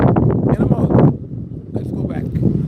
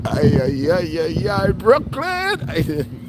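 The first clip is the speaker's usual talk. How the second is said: excited cheer; 6 semitones higher, much slower than usual. Real, not acted. Cheering his home borough.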